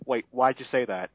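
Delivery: normal talking voice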